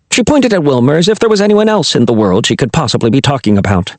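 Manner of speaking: ordinary delivery, nothing marked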